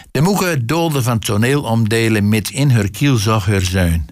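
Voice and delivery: ordinary delivery, nothing marked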